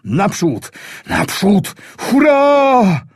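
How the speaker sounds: rising from a call to a high shouted hurrah; building battle cry. Acted. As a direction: whip the platoon into the final charge. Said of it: "Władek krzyczy".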